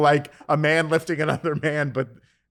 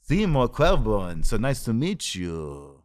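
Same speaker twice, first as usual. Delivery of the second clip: put-on slow hammy Yiddish accent; 3 dB louder, slower than usual. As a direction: drag it out in a stage Yiddish accent. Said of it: "speak with a Yiddish accent".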